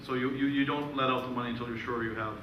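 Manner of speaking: ordinary talk, nothing marked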